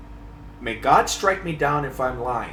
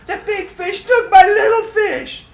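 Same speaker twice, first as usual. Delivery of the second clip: put-on high, loud wail of an upset little kid; louder kid wail; 4 dB louder than usual. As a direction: mimic the boy crying out his loss even louder. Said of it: "even louder".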